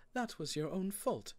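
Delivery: high-pitched motherly rebuke; mum's voice. A narrator's reading. Mum answering her son's complaint, high rebuke. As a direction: scold lightly in a high mum voice. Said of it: "said Mum".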